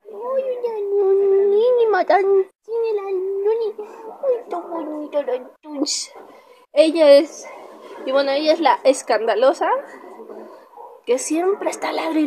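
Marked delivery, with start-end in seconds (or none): 0.1-6.1 mimos a la perrita. Real, not acted. Mimando a su perrita ante cámara. (voz aguda y melosa de mimar al perro)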